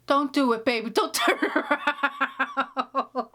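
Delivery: laughing mock plea; mom pleading. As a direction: beg the TV hero like a fond mother.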